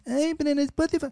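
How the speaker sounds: high, sneering mock complaint; mimicking haters of Spotify, high and sneering; mocking whiny critic. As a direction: scoff like a snob who won't use Spotify. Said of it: "Hay algunos que dicen".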